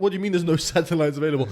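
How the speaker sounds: loud, fast, laughing disbelief; stranded men shouting at the phone; disbelieving shout. Retold or imitated. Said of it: "we're like".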